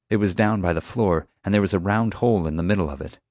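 usual speaking voice

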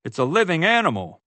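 high, indignant cowboy yell; yelled reprimand. A narrator's reading. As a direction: keep yelling at the careless tourist. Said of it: "the cowboy yelled".

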